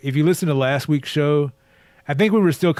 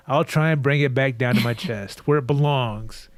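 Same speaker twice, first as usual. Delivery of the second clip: normal talking voice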